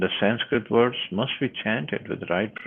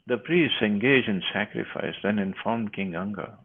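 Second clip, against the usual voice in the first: ordinary talk, nothing marked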